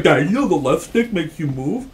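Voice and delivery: mock the game taunting him; a child's mocking dad voice. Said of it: "Be like".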